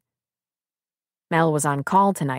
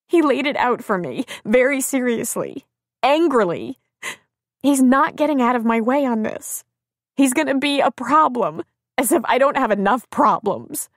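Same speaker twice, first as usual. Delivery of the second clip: high-pitched upset complaint; tearful; 7 semitones higher, 3 dB louder than usual. A narrator's reading. Crying woman complains to friend, tearful. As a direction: lament it through acted tears. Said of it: "wept on her shoulder".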